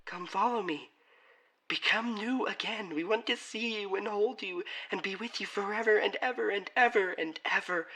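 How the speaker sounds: high, pleading ghostly loved ones; beckoning family voices. Acted. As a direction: lure him in with a high, insistent voice.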